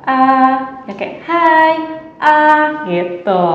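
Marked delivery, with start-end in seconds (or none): 0.1-0.8 "Ah" raised and held level; level first tone. Shown as a demonstration. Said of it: "yang penting datar". 1.2-1.7 "Hai" held high and level; first tone. Shown as a demonstration. Teacher showing the first tone, "Hai" high and level. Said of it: "yang penting datar". 2.2-2.8 "ah" held high and level; first tone. Shown as a demonstration. Teacher showing the first tone, "ah" high and level. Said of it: "itu nada satu".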